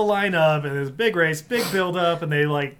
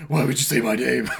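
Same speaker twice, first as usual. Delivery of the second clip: mock Batman voice; 3 semitones lower, 4 dB louder than usual. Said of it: "that was a different Batman".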